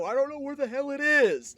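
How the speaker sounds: very loud, high exasperated shout; imitating dad's full-volume yell; dad yelling back. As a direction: holler it back down the stairs. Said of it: "I remember him yelling back down".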